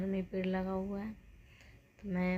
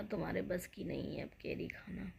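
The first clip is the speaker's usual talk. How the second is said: ordinary talk, nothing marked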